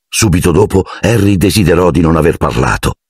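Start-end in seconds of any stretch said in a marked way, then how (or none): none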